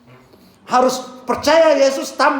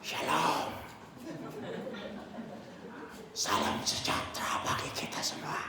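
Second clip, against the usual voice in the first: barely audible whispered greeting; lowered, voiceless whisper; voice gone whisper; 6 semitones lower, 17 dB quieter than usual. Retold or imitated. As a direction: try to greet with no voice left. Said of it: "mana suaranya?".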